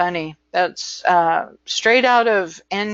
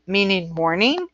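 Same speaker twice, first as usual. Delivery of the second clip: ordinary delivery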